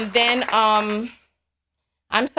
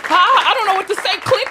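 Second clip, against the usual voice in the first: mock flustered caller; 9 semitones higher, 6 dB louder than usual. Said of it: "Be like".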